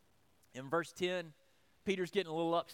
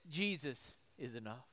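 hushed; 8 semitones lower, 11 dB quieter than usual. Real, not acted. Tender climax of sermon.

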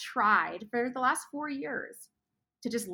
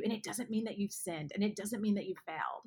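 ordinary talk, nothing marked

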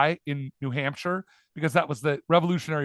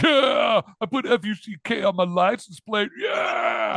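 mock idiot bragging; 7 semitones higher, slower than usual. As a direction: shout it like a proud fool. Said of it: "So they're like".

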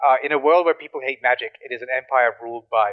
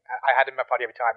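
ordinary talk

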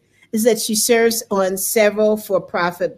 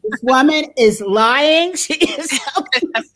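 imagined suspicious thought; 2 semitones higher than usual. Retold or imitated. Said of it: "I could hear in her head".

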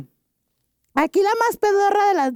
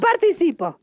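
ordinary delivery

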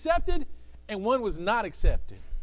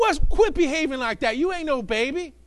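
mock scolding, louder; scolding parent; 5 semitones higher, 6 dB louder than usual. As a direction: chide the kid sharply.

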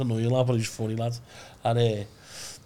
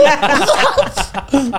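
high loud laugh; 13 semitones higher, 9 dB louder than usual. Real, not acted. Laughs at own story.

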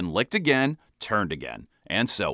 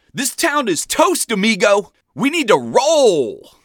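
high, slow, louder hammed-up movie tough guy; action-hero bravado; 9 semitones higher, 5 dB louder, slower than usual. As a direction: ham it up like a cheesy action hero. Action-movie line to the cabbie.

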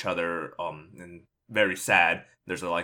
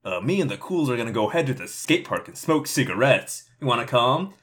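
put-on higher, louder laid-back teen invite; teen slacker voice, pitched up and loud; cool teen dude; 6 semitones higher, 3 dB louder than usual. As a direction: play the cocky cool kid tempting his friend. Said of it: "Billy's like".

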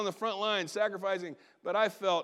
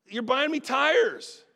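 higher, louder and slow, dragged out in indignation; mock-outraged complaint; aggravated protest; 6 semitones higher, 7 dB louder, slower than usual. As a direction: spit it out like an offended grown man. Aggravated retort to the pastor.

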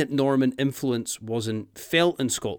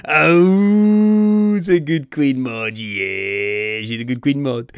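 talking to a dog; 2 semitones higher, 8 dB louder, much slower than usual. Retold or imitated. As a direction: gush over a good doggy. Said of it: "makes her sound like a Doug".

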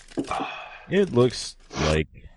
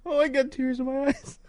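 laughing; 5 dB quieter than usual. Real, not acted. Laughing till tears.

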